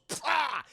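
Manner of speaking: loud sharp spit noise; spitting sound pah. Retold or imitated. Boy biting down and spitting, loud spit noise. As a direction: spit it out hard. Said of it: "and goes".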